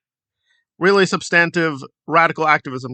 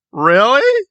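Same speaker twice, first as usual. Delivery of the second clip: rising, louder disbelief; incredulous; 7 semitones higher, 6 dB louder than usual. Real, not acted. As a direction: ask it like you can't believe it. Incredulous reaction.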